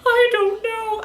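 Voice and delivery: high, hesitant mock shrug; imitating an unwilling classmate; mock timid girl. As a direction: whine it like a shy girl. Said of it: "She's just like".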